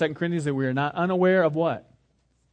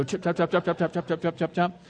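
evenly repeated mechanical chatter; imitated chattering teeth. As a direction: chop along like wind-up toy teeth. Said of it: "you can wind 'em up and they go".

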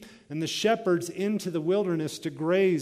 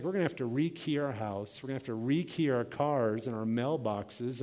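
normal talking voice

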